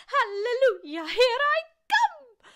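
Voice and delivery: put-on very high, screechy old woman's shout; gleeful shout. A narrator's reading. Grandma bursts up through the floor, screechy triumphant shout. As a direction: holler it out, drawing out the end. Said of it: "she shouted, finding her voice at last".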